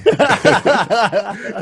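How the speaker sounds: loud high laughter; high delighted laugh. Real, not acted. Host laughing at the merchant impression, loud high laugh. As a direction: burst out laughing.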